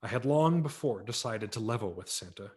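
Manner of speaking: ordinary delivery, nothing marked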